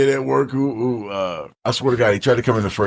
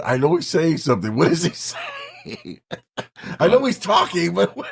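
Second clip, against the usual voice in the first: puzzled whales; 6 semitones higher than usual. Acted. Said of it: "The whales are looking at each other".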